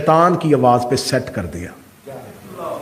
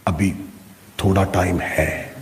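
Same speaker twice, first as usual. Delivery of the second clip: tempting inner voice; 9 semitones lower, 7 dB quieter than usual. Acted. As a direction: quietly put it off, unhurried. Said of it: "دوسری آواز آئی".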